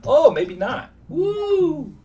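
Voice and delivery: excited cheer at a lucky card; sudden whoop. Real, not acted. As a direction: whoop as the hand turns around. Sudden win at the poker table.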